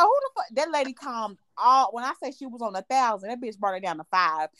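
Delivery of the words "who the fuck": mock outrage. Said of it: "I was like".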